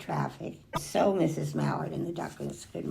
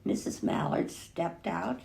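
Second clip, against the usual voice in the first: plain reading voice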